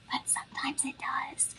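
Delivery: conspiratorial whisper. Real, not acted. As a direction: whisper it like a secret tip. Sly aside about coupon loophole.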